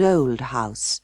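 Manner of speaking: plain reading voice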